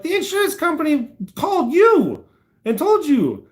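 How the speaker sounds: retelling stunned outrage at the tow dispatcher; incredulous. Retold or imitated. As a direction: spell out the absurdity, voice way up.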